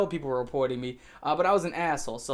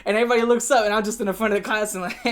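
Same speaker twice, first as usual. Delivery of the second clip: ordinary talk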